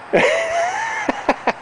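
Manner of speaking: high giggle; light high laugh. Real, not acted. Laughing at the dog.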